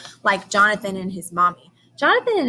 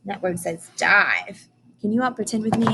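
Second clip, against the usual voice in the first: ordinary talk, nothing marked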